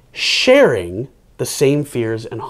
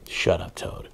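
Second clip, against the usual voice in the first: whispered hush; 13 dB quieter than usual. Real, not acted. Reader hushes the puppet.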